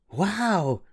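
high, soft delighted wow; girl thrilled by free tickets; happy wow. Acted. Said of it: "Yvonne said happily".

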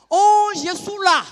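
high, loud, drawn-out phone answer; calling into phone. Acted. Woman answering her phone after storming off, high drawn-out call.

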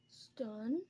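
ordinary talk, nothing marked

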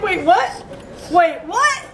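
high-pitched mock confusion, rising at the end; imitated bewilderment. Vlogger teasing Taylor who has no words, mock bewilderment. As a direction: mimic someone stunned and lost. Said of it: "Taylor's like".

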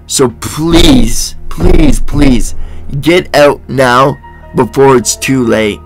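normal talking voice

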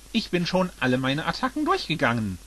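usual speaking voice

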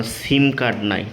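usual speaking voice

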